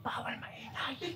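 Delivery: slow mocking whisper with a laugh. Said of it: "sumisigaw ka, pabulong". (pabulong na may tawa, nang-aasar)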